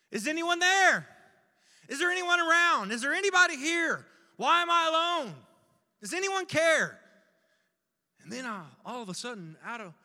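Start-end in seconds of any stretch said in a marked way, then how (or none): 0.1-7.0 high, loud cries for anyone; desperate calling out. Acted. Lost and alone, calling for anyone there, desperate shout. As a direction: shout into emptiness for help.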